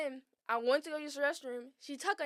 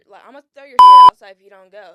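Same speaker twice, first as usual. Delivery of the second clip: loud threat; 2 semitones lower, 30 dB louder than usual. Retold or imitated. Threatening to throw her stuff out.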